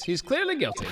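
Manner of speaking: high excited declaration; triumphant. Acted. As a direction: announce it with glee.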